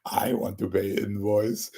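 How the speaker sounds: put-on Indian accent, car salesman pitch. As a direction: imitate an Indian car seller at a dealership. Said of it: "I don't really do Indian impressions, but I'll try".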